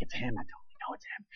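hushed knowing whisper. Retold or imitated. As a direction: whisper it like juicy gossip. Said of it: "a little bit of whispering".

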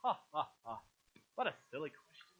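low, dismissive fake "ha, ha, ha"; mock chuckle. Preacher voicing readers who find the question silly, mock chuckle. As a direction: imitate people chuckling condescendingly at a question. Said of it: "we sort of chuckle over".

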